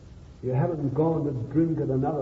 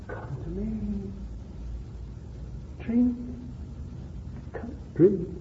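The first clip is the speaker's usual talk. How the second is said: tender invitation; 7 semitones higher, 8 dB quieter, slower than usual. Acted. Jesus voiced tenderly inviting.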